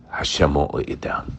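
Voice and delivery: soft whisper of comfort to the listeners; hushed reassurance. Real, not acted. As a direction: breathe it as a promise. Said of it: "quiet reassurance".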